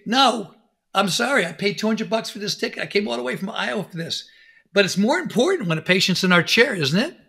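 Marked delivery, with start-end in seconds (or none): 0.1-4.3 mock protest, higher pitched; acted protest. Ticket buyer objecting to the star's excuses, mock protest.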